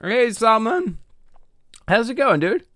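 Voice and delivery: high, loud happy hello; excited greeting. Real, not acted. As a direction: greet a friend with delight. Greeting a chat viewer.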